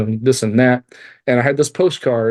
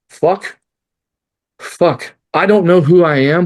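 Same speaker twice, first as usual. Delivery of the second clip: anguished outcry; 6 semitones higher, 5 dB louder, slower than usual. Retold or imitated. Cry of despair to God.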